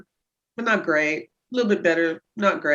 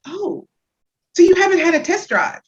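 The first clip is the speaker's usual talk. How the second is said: teasing; 10 semitones higher, 5 dB louder than usual. Retold or imitated. Teasing the husband about sex.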